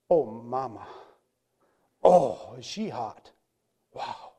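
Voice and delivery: low, leering murmur to himself; mock lustful. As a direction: act an ogling husband, privately wowed. Acted husband ogling pornography alone.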